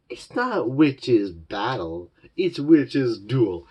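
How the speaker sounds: slow, pedantic correction rising on duel; nerdy correction. Retold or imitated. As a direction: correct her like a know-it-all fan. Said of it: "the inner nerd inside of Luz is like".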